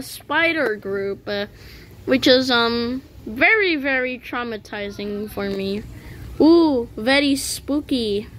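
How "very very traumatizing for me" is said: usual speaking voice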